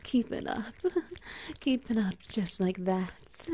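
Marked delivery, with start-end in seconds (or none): 0.8-1.5 soft, high breathy giggle; a teasing little laugh. Acted. Lover giggles while urging partner on, teasing.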